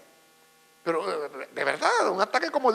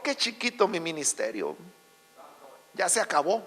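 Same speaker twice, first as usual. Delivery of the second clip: low, subdued resignation; softly acted self-pity, pitched down; discouraged inner thought; 5 semitones lower, 8 dB quieter than usual. Daniel doubting himself after years forgotten, discouraged and subdued.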